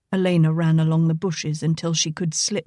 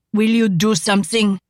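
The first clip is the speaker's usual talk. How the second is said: put-on exasperated French-accented outburst; railing; 3 dB louder than usual. A narrator's reading. Frenchman rails at the sheriff, exasperated and loud. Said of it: "the Frenchman railed".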